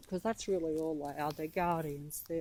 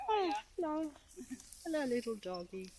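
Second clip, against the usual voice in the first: pet talk; 8 semitones higher, 5 dB louder than usual. Real, not acted. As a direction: greet the little dog sweetly. Greeting a dog in park.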